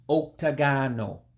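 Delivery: octagonal said ohk-tah-GAH-noh, opening on oh; corrected pronunciation. Shown as a demonstration. Teacher correcting own slip for learners, corrected pronunciation. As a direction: say octagonal as oke-ta-gah-no, with a round first vowel. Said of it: "I said it incorrectly".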